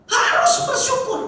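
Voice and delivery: "Harus bersyukur" high and drawn out; forceful emphasis. Shown as a demonstration. Paul insisting to believers, hard-stressed "Harus bersyukur". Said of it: "tekanan yang keras".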